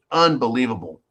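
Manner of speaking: ordinary talk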